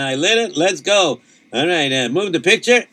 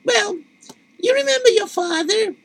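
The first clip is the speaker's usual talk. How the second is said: wife voice. Acted. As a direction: play the worried wife, pitched way up. Wife voice answers husband.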